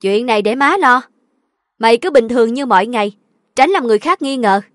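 firm mother. A narrator's reading. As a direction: take charge firmly. Mother gives firm instructions. (dặn dò dứt khoát)

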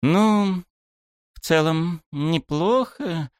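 high-pitched slow appraisal; drawled. A narrator's reading. Club head examining photos, drawled. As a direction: stretch it out while judging. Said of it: "протянула глава".

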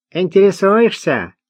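высоко, с усмешкой в голосе; ухмыльнуться, поддевая Луи; с ухмылкой. A narrator's reading. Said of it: "ухмыльнулся". (насмешливый вопрос бродяги)

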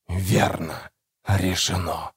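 near-whisper from the tree; low, breathy near-whisper from the branches; talking tree, almost whispering. A narrator's reading. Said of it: "почти прошептал голос с дерева".

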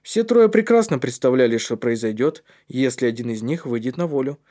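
ordinary delivery, nothing marked

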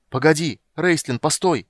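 loud high cry, voice breaking; cracking shout. Acted. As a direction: shout after him, voice cracking. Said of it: "кричал он срывающимся голосом".

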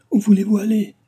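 high, loud, urgent call; crying out. A narrator's reading. Delville following Cécile as she walks away, crying out. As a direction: call after someone walking away. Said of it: "s'écria Delville en la suivant".

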